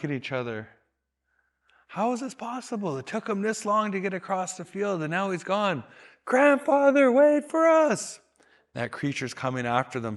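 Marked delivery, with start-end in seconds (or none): 1.9-5.8 surprised. Retold or imitated. Young men puzzled at old man. 6.2-8.2 high, loud call, slow on wait for us; calling after. Retold or imitated. Calling after the old man.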